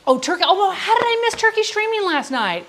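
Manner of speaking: high, surprised outburst; sudden realization. Real, not acted. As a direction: catch yourself remembering something. Sudden excited remembering.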